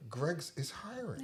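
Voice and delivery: slowed right down, softly deadpan; slow repeat. Real, not acted. Repeating job tip for a puzzled friend, slow and soft. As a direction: spell it out slowly and quietly.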